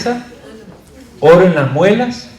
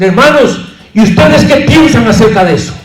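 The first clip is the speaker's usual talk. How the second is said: loud, raised, quick pulpit question; preacher's question; 5 semitones higher, 7 dB louder than usual. Shown as a demonstration. Teacher demonstrating a question to the brethren before pausing, loud preaching. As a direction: throw the question out to the congregation. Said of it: "Y tú puedes decir".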